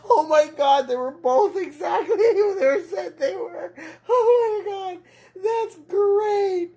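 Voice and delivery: very high, loud, squealing through laughter; gasping the words out mid-laugh; real tearful laughing; laughing to tears. Real, not acted. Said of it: "I'm laughing so hard I'm crying".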